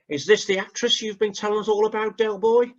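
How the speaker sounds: a hint of a smug mate's mockery, mostly your own voice; Boycie voice. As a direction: sneer it like the pub know-all teasing Del. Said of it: "I'm trying to do Boise impression".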